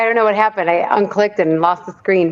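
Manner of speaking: normal talking voice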